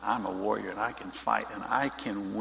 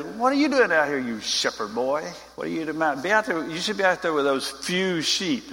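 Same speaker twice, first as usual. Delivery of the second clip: high, loud taunting big-brother voice; older brother mocking David, high and loud; 5 semitones higher, 3 dB louder than usual. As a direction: sneer down at the little shepherd boy. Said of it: "he says".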